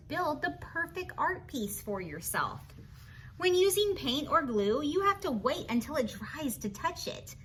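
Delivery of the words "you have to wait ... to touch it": ordinary delivery, nothing marked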